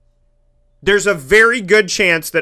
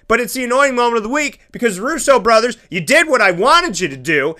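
usual speaking voice

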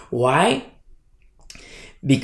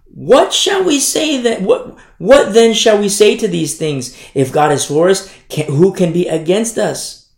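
emphatic scripture reading; 5 semitones higher, 5 dB louder than usual. Acted.

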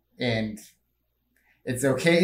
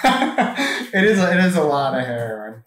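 laughing; 4 dB louder than usual. Real, not acted.

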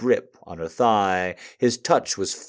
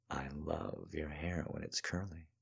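hushed, much softer voice; soft compliment; 4 semitones lower, 20 dB quieter than usual. Acted. Stephen complimenting Cassandra's hair, softly hushed. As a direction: murmur it gently and close.

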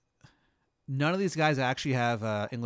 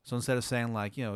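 normal talking voice

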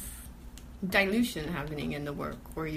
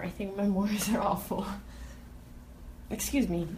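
burping. Real, not acted. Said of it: "I'm drinking beer and I'm burping a little".

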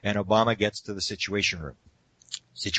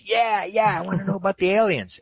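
put-on higher, louder eager boy; eager kid voice; 9 semitones higher, 4 dB louder than usual. Boy answering the president, eager higher kid voice. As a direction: gush it out like a keen teenager.

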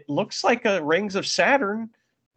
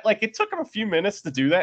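usual speaking voice

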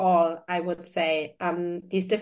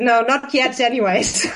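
laughing; 3 semitones higher, 7 dB louder than usual. Real, not acted. Joking about researching it later.

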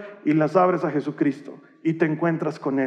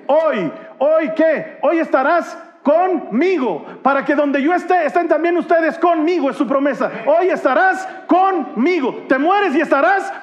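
high, emphatic preacher's proclamation; rousing climax of a sermon; impassioned preaching; 7 semitones higher than usual. Real, not acted. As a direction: drive the promise home, stretching the key words. Preaches the promise at full force.

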